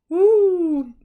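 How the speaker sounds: loud, high spooky ghost hoot; playful ghost sound for the kids; ghost woo. Acted. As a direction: wail it like a silly ghost scaring the family. Parrot plays ghost in chimney.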